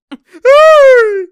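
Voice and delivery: high, loud burst of laughter; shrieking laugh. Real, not acted. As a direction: cackle out loud at the play. Excited reaction to a play.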